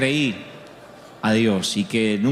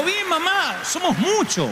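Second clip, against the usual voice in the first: put-on calling out to old mother; slower than usual. Loud reply to confused mother.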